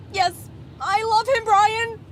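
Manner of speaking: loud, high declaration; dramatic confession. Acted. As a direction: blurt out the love. Confession in sketch.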